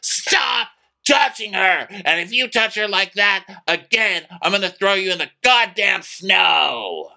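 imitating a high furious scream; high-pitched mock screamed warning. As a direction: yell the threat way up high, mimicking an outraged guy. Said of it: "Craig just starts screaming".

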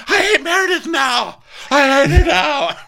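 very high, loud mock shriek; exaggerated squealing rage, way up high; Lisa outburst. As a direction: screech it like a hammed-up tantrum. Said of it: "Lisa was like".

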